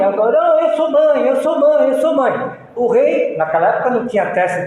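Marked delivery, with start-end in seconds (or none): 0.4-2.6 raised pleading claim, over and over; a mother insisting the child is hers; lightly voiced mother pleading her claim; insistent mother.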